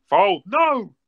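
loud, high yell at the screen; shouting. Real, not acted. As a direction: shout it out at the cards. Yelling at poker opponent.

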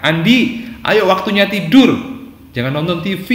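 high, loud scolding order; snapping parent. Shown as a demonstration. Parent ordering Andi to bed and off the TV, snapping. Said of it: "dinasihatkan orang tua dengan bentakan itu".

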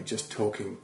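ordinary talk, nothing marked

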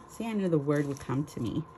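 usual speaking voice

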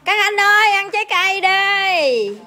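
high, slow, drawn-out call. Real, not acted. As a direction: call them over from afar. Calling the men to eat.

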